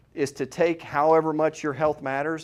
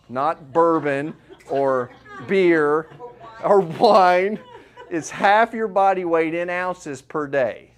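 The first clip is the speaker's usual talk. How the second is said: laughing; 3 semitones higher, 7 dB louder, much slower than usual. Real, not acted. Joking that water is not bourbon.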